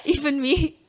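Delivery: crying while laughing. Real, not acted. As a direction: let the tears break into "Even me?". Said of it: "you make me cry".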